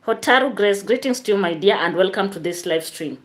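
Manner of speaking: normal talking voice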